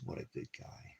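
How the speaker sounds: hushed whisper to the dog; whispered, tender pet praise. Real, not acted. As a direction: breathe it to the dog softly.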